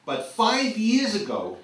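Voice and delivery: high, loud, drawn-out mock disbelief; exasperated parent. Parent on kids' selective memory, mock exasperation. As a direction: stretch it out, high and incredulous.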